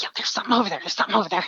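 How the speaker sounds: hushed but pressing; insistent whisper. Retold or imitated. Jamie whispering to him that something is there, insistent hush.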